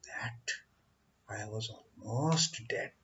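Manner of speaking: faint, breathless whisper. Acted. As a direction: barely whisper it, as if dying. Weak snake recalling near death.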